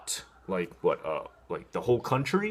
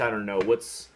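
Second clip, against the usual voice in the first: usual speaking voice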